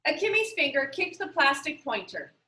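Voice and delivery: usual speaking voice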